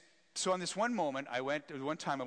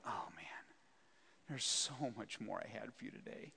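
softer, sighing God; whispered regret; disappointed whisper; 3 semitones lower, 7 dB quieter than usual. Retold or imitated. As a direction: gently let down.

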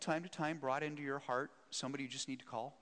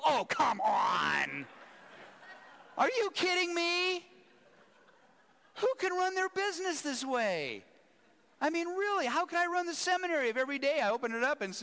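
much higher and louder, slowed, exasperated; incredulous outburst, much higher and loud; exasperated rant; 7 dB louder than usual. Retold or imitated. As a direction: let it all out once the elevator doors close. Frustrated rant alone in elevator.

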